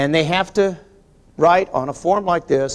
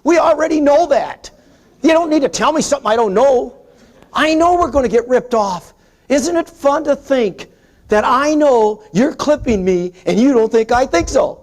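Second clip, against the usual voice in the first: much higher, louder, delighted; gleeful retold reply; 11 semitones higher, 7 dB louder than usual. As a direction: revel in it like good news. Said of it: "And I tell them".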